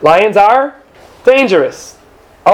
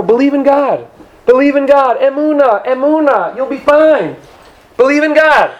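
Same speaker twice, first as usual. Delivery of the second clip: preaching reassurance; 6 semitones higher than usual. Acted. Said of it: "preaching the simple reading".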